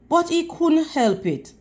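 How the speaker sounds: ordinary delivery, nothing marked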